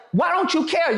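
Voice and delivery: heated question; heated retort. Retold or imitated. Arguing back at Keith.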